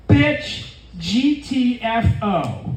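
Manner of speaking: mock Gen Z girl, drawn out slowly; Zoomer chick impression. Comedian imitates Zoomer chick talking to her friend, drawn-out impression. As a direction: imitate a Zoomer chick, stretching each letter. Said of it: "it went something like this".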